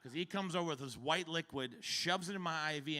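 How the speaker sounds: usual speaking voice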